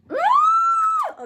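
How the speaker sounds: high piercing yelp of pain; sharp scream. Real, not acted. Girl piercing her own ear, high pained scream. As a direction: yelp as the piercing gun clicks.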